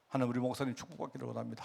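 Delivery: silent prayer. Shown as a demonstration. Preacher models a silent inner prayer for the congregation, soft and quick. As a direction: pray it under your breath, fast and soft. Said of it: "속으로 해보세요".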